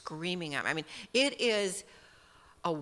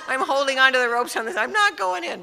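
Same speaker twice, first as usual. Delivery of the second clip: high, loud and rushed, clinging on for dear life; a retold panicked cry, high and loud; mock frantic refusal; 6 semitones higher, 13 dB louder than usual. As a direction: blurt it out in a panic, much higher and louder. Retelling clinging to raft, refusing.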